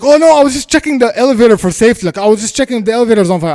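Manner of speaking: fast, high, loud babble; rushed mock-innocent backtracking; imitated flustered excuse. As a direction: mimic a man hastily covering himself.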